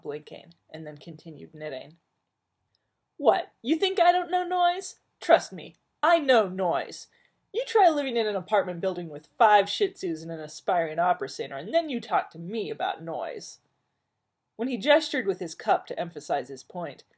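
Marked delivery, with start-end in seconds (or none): 3.2-13.6 loud, high, fed-up retort; Marilyn exasperated. Acted. Wife scoffing back at Joel, loud and exasperated.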